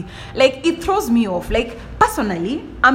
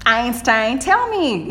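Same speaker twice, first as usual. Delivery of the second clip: taunting challenge; slower than usual. Retold or imitated. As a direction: goad him to say it, pitched up. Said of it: "I was like".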